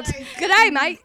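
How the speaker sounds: loud, high-pitched Aussie greeting, "day" said like "die"; Australian accent. Shown as a demonstration. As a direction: put on a broad Australian accent. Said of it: "you have to start with".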